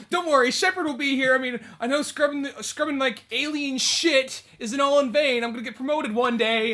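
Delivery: high, loud mock-earnest reassurance; hopeful crewman. Toilet scrubber reassuring captured shipmates, hammed-up hopefulness. Voices doomed crewman hoping for rescue.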